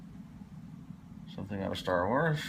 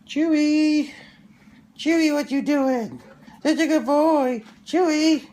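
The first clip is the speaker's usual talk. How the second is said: high squeaky dog praise; squeaky praising a dog; 12 dB louder than usual. Real, not acted. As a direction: coo loudly at the dog. Praising his dog in baby voice.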